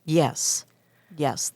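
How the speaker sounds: ordinary talk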